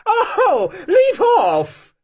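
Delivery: high squeal through laughter; screaming and laughing. A narrator's reading. Marianka being tickled by Ustenka, laughing shriek. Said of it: "screaming and laughing".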